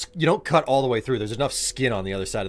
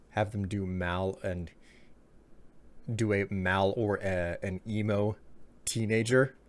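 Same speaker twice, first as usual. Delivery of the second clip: usual speaking voice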